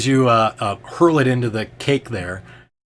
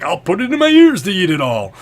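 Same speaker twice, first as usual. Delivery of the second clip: high, loud booming jester laugh and boast; much higher and louder, hamming it up; jolly Fabler; 9 semitones higher, 8 dB louder than usual. Acted. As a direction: play the bold, showy fool at the feast. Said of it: "he's like".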